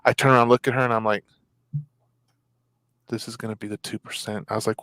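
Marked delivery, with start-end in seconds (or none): 3.1-4.4 much softer, low dread; whispered dread to his wife; grim aside. Retold or imitated. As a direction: mutter it under your breath. Aside to wife, fearing the worst.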